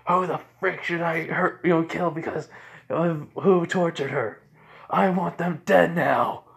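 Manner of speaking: loud, high-pitched angry rant; vengeful outburst. Acted. Furious about who tortured her.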